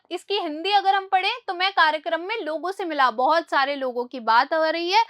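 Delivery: usual speaking voice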